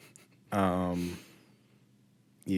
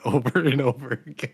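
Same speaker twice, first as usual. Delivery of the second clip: laughing; 5 semitones higher, 5 dB louder than usual. Real, not acted. Laughing at the chorus.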